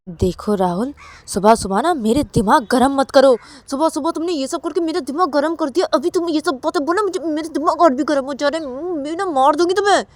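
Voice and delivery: loud, high furious scolding; wife furious at husband, loud and high; angry threat. Acted. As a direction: lose your temper and threaten him.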